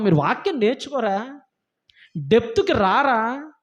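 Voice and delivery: normal talking voice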